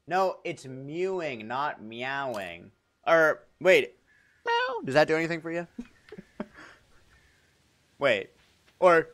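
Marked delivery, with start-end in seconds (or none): 4.5-4.8 high squeaky meow; cat meow. Pretend. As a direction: mew like a kitten. Cat sound after mewing joke.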